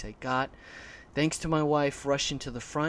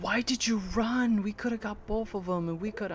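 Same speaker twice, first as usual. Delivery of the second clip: high mock scolding voice; imitated wife's complaint; 7 semitones higher than usual. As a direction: plead in a higher voice, imitating the wife. Mimics wife scolding him.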